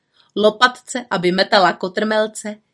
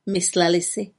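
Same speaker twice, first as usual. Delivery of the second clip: ordinary delivery, nothing marked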